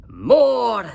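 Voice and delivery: loud, high one-word demand; teacher dragon command. A narrator's reading. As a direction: bark it at the class. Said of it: "said Madam Dragon".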